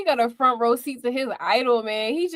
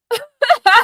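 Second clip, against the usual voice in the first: loud high laugh; 9 semitones higher, 10 dB louder than usual. Real, not acted. Laughs at the Paul mix-up.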